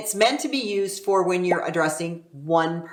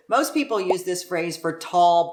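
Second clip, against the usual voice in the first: normal talking voice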